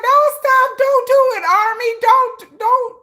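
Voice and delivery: high, loud pleading yell; mock yell. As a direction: beg the fans to stop. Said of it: "I was like".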